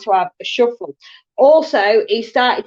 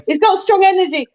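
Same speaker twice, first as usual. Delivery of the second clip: laughing; 9 semitones higher, 3 dB louder than usual. Real, not acted. Laughing after shared joke.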